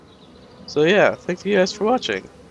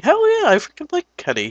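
excited; 13 semitones higher, faster than usual. Real, not acted. Enthusing about a game character.

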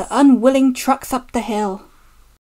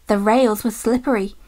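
plain reading voice

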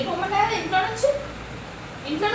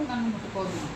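much lower and softer, sulking; sulky low mutter; 8 semitones lower, 9 dB quieter than usual. Real, not acted. Sulking child refusing to be touched, low sulky mutter. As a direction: mumble it into yourself, hurt.

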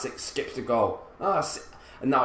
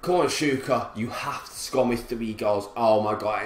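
ordinary delivery

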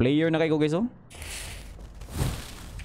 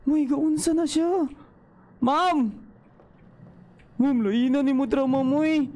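high nervous complaining; 11 semitones higher than usual. Real, not acted. Freaked out by game character.

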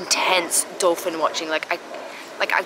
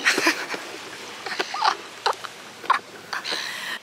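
breathy laugh. Real, not acted. Laughs at her own joke.

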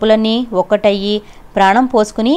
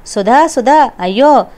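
louder, distressed calling out; panicked cry; 4 semitones higher, 5 dB louder, slower than usual. A narrator's reading. Frightened father calls out for Sudha, panicked. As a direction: call for help in rising alarm. Panicking man cries for his son.

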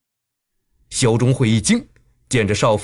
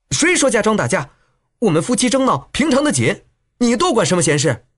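high-pitched scolding wife; indignant retort; 7 semitones higher than usual. A narrator's reading. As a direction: tell the meddler to butt out, pitched up. Wife snaps at meddling stranger.

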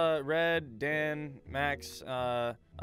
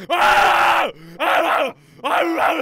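screaming; 12 semitones higher, 15 dB louder, much slower than usual. Acted. Game scene, shouting.

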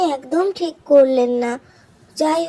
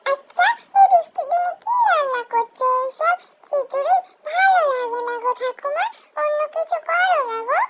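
put-on whiny child; 8 semitones higher than usual. Child whining to grandma.

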